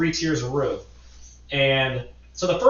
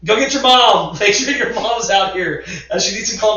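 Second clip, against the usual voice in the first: high, loud yell down from the roof; reenacted shout for help; calling out; 7 semitones higher, 6 dB louder than usual. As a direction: holler urgent orders to a kid below. Yells back down from the roof.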